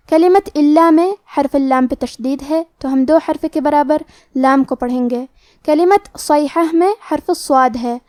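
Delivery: ordinary talk, nothing marked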